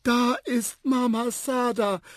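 put-on high, drawn-out elephant child's cry; excited call. A narrator's reading. Little elephant spotting his mother, high excited call. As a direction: call out high and slow with joy. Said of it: "rief der kleine Elefant".

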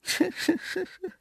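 tiny high creature giggle; high giggly chuckle. A narrator's reading. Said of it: "gluckste es auf der anderen Seite". (hohes Glucksen)

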